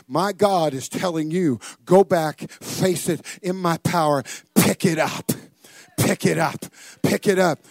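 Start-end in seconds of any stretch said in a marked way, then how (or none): 1.8-7.6 loud, emphatic preacher's command; urgent pulpit charge, punched hard; forceful exhortation. Acted. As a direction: drive each command home, louder and louder. Preacher relays God's urgent command.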